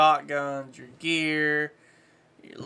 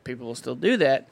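ordinary delivery

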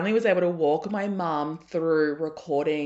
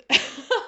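high, louder short laugh; burst of laughter; 9 semitones higher, 5 dB louder than usual. Real, not acted. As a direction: laugh out at the memory. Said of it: "I'm laughing because".

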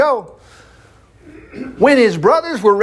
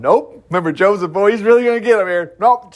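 ordinary talk

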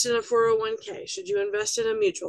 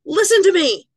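high, loud scolding mom; mom shouting; 8 semitones higher, 9 dB louder than usual. Retold or imitated. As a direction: grab them by the ears and yell it like a mother. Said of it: "like it's your mom".

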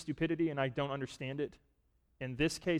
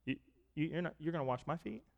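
softer, hesitant disbelief, stumbling over the words; quiet reluctant protest; 6 dB quieter, faster than usual. Retold or imitated. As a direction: hold back as if embarrassed to be served. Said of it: "he said it with reluctance in his voice".

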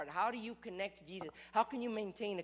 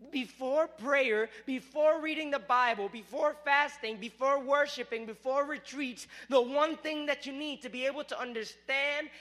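preaching at full force; 3 semitones higher, 6 dB louder than usual. Acted. Preacher rousing the congregation, loud and emphatic. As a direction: drive it home to the congregation.

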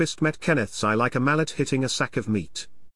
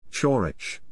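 plain reading voice

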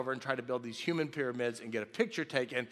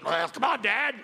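higher, louder mock complaint; protesting grown son; 7 semitones higher, 6 dB louder than usual. Grown son to dad about the T-shirt game, mock protest. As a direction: imitate a grown-up son balking loudly.

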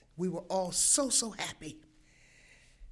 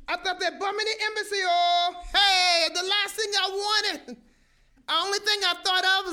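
panic; 8 semitones higher, 5 dB louder than usual. Retold or imitated. Reliving panic of bombing.

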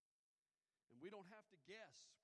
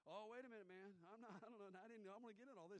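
high, louder flustered retreat; flustered backing off; 7 semitones higher, 7 dB louder than usual. Retold or imitated. Worker startled he's talking to a preacher, flustered. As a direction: panic a little and back away.

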